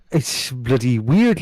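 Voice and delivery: put-on slow, laughing half-American, half-European accent; slow mongrel accent, half laughing; odd accent. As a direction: slip into a strange mixed accent. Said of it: "I don't know what accent that is".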